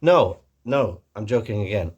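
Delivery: ordinary delivery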